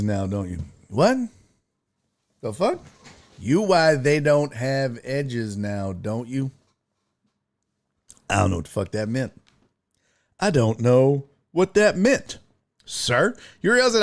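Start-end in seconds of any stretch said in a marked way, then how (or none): 3.4-6.5 slow, spaced-out words; puzzled careful reading. Real, not acted. Rereads garbled comment word by word. 8.3-9.3 fast, run-together words; quick dismissal. Real, not acted. First, quick admission of confusion. 10.4-12.4 measured, each word separated; careful enunciation. Shown as a demonstration. Repeats it carefully, without the swearing.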